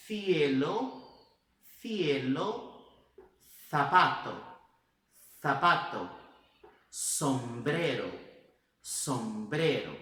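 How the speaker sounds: "cielo" and "zapato" with a th, "sombrero" with an S; "zapato" lisped, "sombrero" with a slow S; Spain Spanish contrast. Shown as a demonstration. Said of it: "Olha de novo a diferença".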